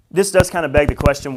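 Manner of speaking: ordinary talk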